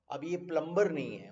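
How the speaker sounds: ordinary talk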